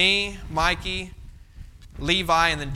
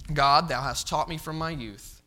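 solemn scripture reading; 3 semitones lower, 3 dB quieter than usual. Acted.

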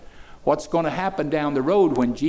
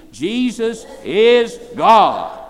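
louder and higher, slow, each word hammered; a louder second call for the crowd to echo; loud slow repeat; 7 semitones higher, 9 dB louder, much slower than usual. Shown as a demonstration. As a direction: make them shout it back with you. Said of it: "Say it again".

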